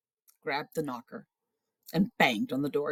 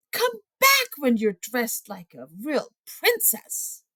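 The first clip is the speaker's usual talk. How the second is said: much higher, slow, disdainful prince; snooty prince; 8 dB louder, slower than usual. Acted. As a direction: look down your nose at her.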